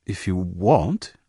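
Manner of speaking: hesitant, drawn-out, slow words; stammering. A narrator's reading. Ben backpedals to Diana, stammering. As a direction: falter shyly over the invitation. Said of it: "he stammered".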